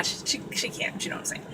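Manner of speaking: unvoiced confiding whisper; real hushed aside about her daughter. Real, not acted. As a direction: drop to a whisper as if sharing a secret.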